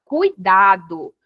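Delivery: ordinary delivery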